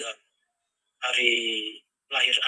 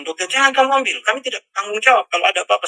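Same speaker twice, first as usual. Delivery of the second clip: much higher, louder and fast urgent warning; alarmed doctor; 9 semitones higher, 9 dB louder, faster than usual. Retold or imitated. Doctor warns against taking child.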